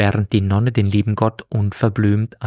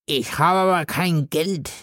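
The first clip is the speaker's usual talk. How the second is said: put-on drunken plea; 7 semitones higher than usual. A narrator's reading. God pleads.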